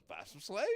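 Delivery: questioning rise on "slaves"; questioning echo. Retold or imitated. Man queries the advice to buy, "slaves" rising in question.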